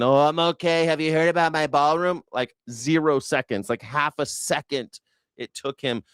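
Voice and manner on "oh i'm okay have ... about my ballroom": high, breezy mock Trump; Trump impression. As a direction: toss it off like he doesn't care. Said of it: "They said".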